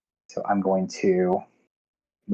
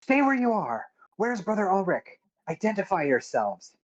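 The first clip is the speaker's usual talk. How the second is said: higher-pitched guard's challenge; challenging call; 9 semitones higher than usual. Acted. Guard challenges the party arriving by boat, calling out. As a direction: call out a sharp demand to newcomers.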